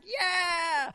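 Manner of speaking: high excited cheer, far up; cheering yell. Retold or imitated. Onlookers cheering the rider who stayed on, high whooping yell.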